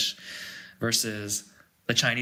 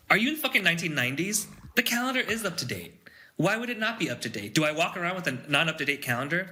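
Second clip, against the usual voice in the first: higher-pitched, exasperated disbelief; indignant rant; 4 semitones higher than usual. Acted. Ranting at coworkers who email about the calendar, indignant. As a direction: tell off a clueless coworker, incredulous.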